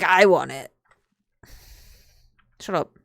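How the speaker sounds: ordinary talk, nothing marked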